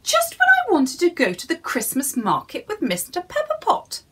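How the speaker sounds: hint of Missus Pepperpot; lightly voiced little woman. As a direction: grumble about missing the market. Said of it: "she said".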